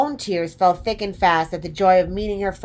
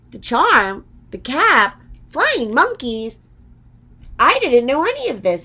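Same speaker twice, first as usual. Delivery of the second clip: raised, louder, puzzled questions; astonished girl catching up; surprised; 7 semitones higher, 5 dB louder than usual. A narrator's reading. Dorothy hearing of the cap's magic, raised and surprised. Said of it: "answered Dorothy in surprise".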